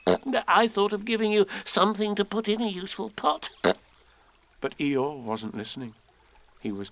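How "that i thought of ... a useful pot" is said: high, pleased little voice; small, gleeful squeak; happy Piglet. A narrator's reading. Piglet pleased with his gift, happy small voice. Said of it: "said Piglet happily".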